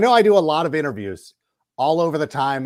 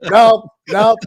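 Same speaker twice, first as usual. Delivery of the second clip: higher, louder playful no; mock brush-off; 7 semitones higher, 7 dB louder, much slower than usual. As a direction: wave people off teasingly. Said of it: "and being like".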